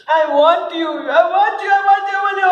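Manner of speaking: put-on childish whining plea, climbing; rising craving. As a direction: build the wanting like a child. Man begs for reflection.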